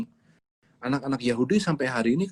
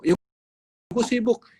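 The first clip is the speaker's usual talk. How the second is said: higher, louder whiny excuse; busy excuse; 9 semitones higher, 6 dB louder than usual. Retold or imitated. Parent excusing themselves from teaching, whiny and higher. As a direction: whine that you're too busy.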